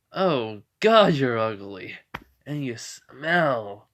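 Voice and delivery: drawn-out, disgusted put-down, lightly acted; mock disgust. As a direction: stretch out the insult, loud on "Oh God". Hero insults the villain in story.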